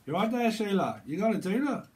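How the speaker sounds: loud, high Aussie greeting; Aussie accent. Acted. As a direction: hail someone in a mock Australian accent. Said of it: "someone could be from, uh, Aussie".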